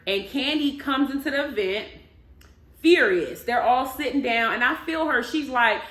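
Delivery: usual speaking voice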